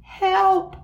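high-pitched cry for help; screamed. A narrator's reading. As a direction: scream in panic, trapped in the tent. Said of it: "screamed Buster".

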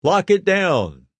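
measured alert call, lightly acted; urgent radio order. A narrator's reading. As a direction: draw out the order over the radio. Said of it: "calls into her walkie-talkie".

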